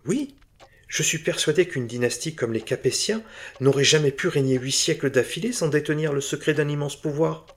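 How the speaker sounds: a keen bric-a-brac dealer pleading his case; eager antique dealer. Acted. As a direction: press your request with keen hope. Bourdet voiced higher.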